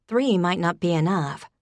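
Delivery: ordinary delivery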